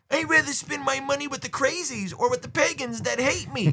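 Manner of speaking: high, silly goober voice; mocked complainers. Mocking goobers who object to merch sales, silly high voice. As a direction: hold it up high in a mocking whine. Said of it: "you're like".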